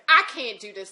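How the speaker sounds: retold loud protest; overwhelmed outburst. Telling the man it's too much, overwhelmed outburst.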